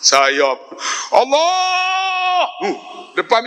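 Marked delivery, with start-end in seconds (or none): 1.1-2.5 high, drawn-out imitation of a wail; mock wailing. Preacher retells crying in prostration at the mihrab, mock wailing. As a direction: wail it out long and high, hammed-up. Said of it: "Kita teriak".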